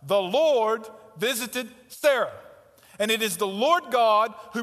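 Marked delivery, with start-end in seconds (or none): 0.0-2.4 slow emphasis. Shown as a demonstration. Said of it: "There's the point, friends".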